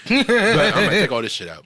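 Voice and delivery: repeating the line through a laugh. Real, not acted. To his friends, repeating himself while laughing.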